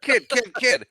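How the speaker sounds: put-on high, loud hail to a kid; calling out. Officer stopping a passing kid, calling out. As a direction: call out urgently to grab a passerby's attention.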